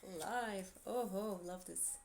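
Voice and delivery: ordinary talk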